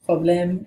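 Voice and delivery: problème said the French way, pro-BLEM; problème with French vowels; French pronunciation. Shown as a demonstration. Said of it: "in French and English".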